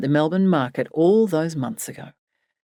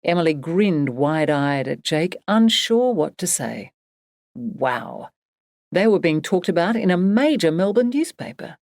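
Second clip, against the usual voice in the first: ordinary delivery, nothing marked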